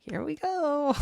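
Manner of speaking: high, half-joking nervous quip; bracing for the long flight, mock-nervous; nervous flyer. Streamer imagining takeoff, lightly joking nervous voice. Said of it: "so I'm like".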